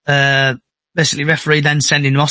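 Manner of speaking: ordinary talk, nothing marked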